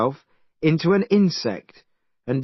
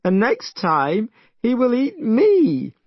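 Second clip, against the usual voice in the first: crying; 10 semitones higher, 3 dB louder than usual. Acted. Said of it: "still crying".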